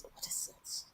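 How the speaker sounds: whispered aside to himself; whispering. Real, not acted. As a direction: drop to a whisper, searching for the word. Muttering to himself mid-rant.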